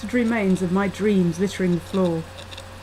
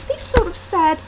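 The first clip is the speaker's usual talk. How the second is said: usual speaking voice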